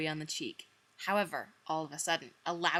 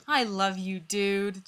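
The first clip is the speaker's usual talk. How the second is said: sighing; 5 dB louder, slower than usual. Acted. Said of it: "Joey sighed".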